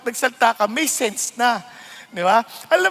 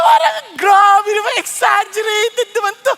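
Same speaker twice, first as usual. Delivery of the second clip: mock disbelief; 8 semitones higher, 6 dB louder than usual. Reacting to exaggeration.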